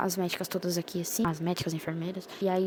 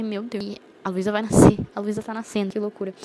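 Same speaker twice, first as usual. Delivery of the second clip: stunned realization; 8 dB louder than usual. Retold or imitated. Shock at the crowd in the room.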